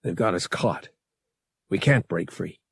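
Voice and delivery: dull, defeated statement; dully. A narrator's reading. Pilot caught in a tractor beam, dully. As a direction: state it with resignation. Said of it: "Han said dully".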